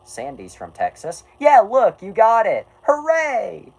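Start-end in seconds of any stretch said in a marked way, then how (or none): 1.4-3.7 much higher, loud cartoon cheer; mock cheering. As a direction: celebrate like a cartoon sidekick.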